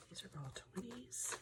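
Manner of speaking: whispered aside; hushed note about the twenties. Real, not acted. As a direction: whisper it to yourself while counting. Aside while counting cash.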